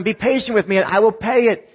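a servant begging, hammed up and high; pleading. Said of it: "he pleaded".

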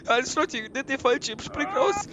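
mock panic. Panic in crashing plane.

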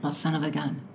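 low, quiet, offhand catchphrase; imitating boyfriend. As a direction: quote the boyfriend softly and low. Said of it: "boyfriend said".

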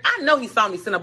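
ordinary delivery

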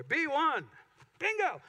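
loud, high bingo call; bingo caller. Pretend. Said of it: "I felt like a bingo caller there".

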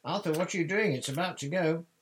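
high, loud hurrying cry; urgent calling. A narrator's reading. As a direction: hurry your husband along from a distance. Wife calling husband to departing train.

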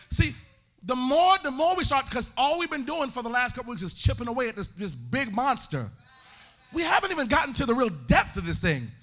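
loud, sing-song preaching cadence; full-force preaching. Acted.

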